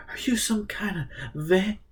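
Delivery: hushed, unvoiced, breaking off; frightened whisper. Richard whispering to the stranger, afraid to say vampire. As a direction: whisper it, too scared to finish.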